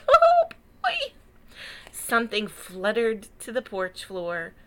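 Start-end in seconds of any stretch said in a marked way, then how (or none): 0.1-1.1 high, loud gleeful exclamation. Real, not acted. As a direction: squeal with delight at the candy. Reader's delighted exclamation.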